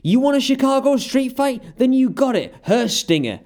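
high, loud mock challenge; mock shout. Lex challenging Sting on the way out, in a high, loud mock shout. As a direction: bellow the challenge like a wrestler. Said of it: "he says".

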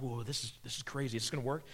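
whisper-close worry among friends; a quiet, nervous aside in the boat; hushed doubtful disciples. Acted.

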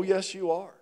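ordinary talk

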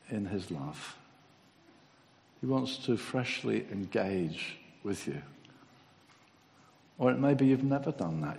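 altar-call prayer. Acted. Preacher inviting the congregation to come and pray, softly. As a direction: gently invite them forward.

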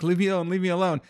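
high, frightened begging, imitating a terrified guy; playing the scared victim begging the bunny; panicked plea. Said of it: "freaking out".

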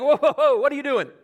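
high, loud startled whoa with a laugh; mock alarm. Disciples shooing parents away, mock alarmed and laughing. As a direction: playfully voice the disciples waving people off.

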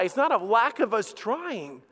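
normal talking voice

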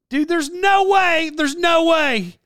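stretched, incredulous no way; disbelief. Retold or imitated. Rewatching the movie, stunned, slow high disbelief. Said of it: "And I'm like".

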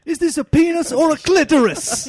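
very high, loud chef yell; furious chef. Retold or imitated. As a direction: scream in disbelief. Ramsay scene continued.

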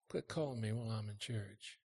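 hushed phone whisper. Pretend. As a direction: hiss it into the phone quietly. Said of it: "I just did it for the effect".

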